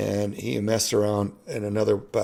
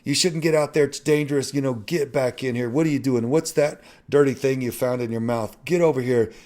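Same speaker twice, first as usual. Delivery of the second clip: higher, louder scolding of a runaway dog; wife scolding the dog for escaping, higher and louder; upset wife scolding the dog; 6 semitones higher, 3 dB louder than usual. Retold or imitated. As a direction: chide the dog like a worried mom. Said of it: "Tressa was upset with him".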